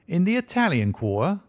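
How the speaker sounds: a self-satisfied detective gloating a little; smug inspector. Acted. Lestrade questions Holmes.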